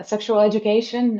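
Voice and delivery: usual speaking voice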